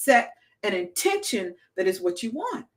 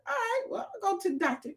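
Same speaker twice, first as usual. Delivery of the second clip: meek agreement; 9 semitones higher, 8 dB quieter, faster than usual. Retold or imitated. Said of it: "I'm like".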